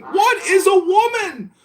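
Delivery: high-pitched mock debater; mocking quote. Mimicking people arguing all day, high mocking quote.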